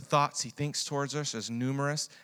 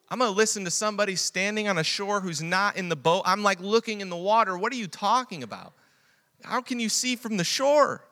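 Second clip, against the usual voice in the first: preaching at full force; 10 semitones higher, 7 dB louder than usual. Acted. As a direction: preach it out to the whole church. Preacher voicing doubt.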